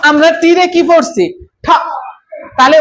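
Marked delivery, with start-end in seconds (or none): none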